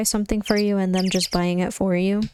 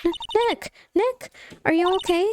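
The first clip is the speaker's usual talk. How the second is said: high, stammered cry of worry; alarmed sidekick; 10 semitones higher than usual. Acted. Sidekick calling to fallen Nick, high alarm. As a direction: call out high in acted alarm.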